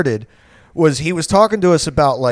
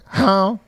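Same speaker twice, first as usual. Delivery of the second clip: high-pitched imitated honk; distant goose honk; 7 semitones higher than usual. Goose floating out on the lake, distant honk. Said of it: "From, like, way far off".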